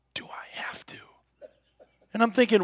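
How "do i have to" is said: hushed whisper so the antelope won't hear. Retold or imitated. Son whispering to his dad in the blind, whispered. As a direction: breathe the words out with no voice.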